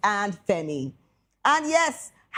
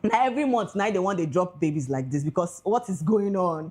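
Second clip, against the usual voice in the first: ordinary talk, nothing marked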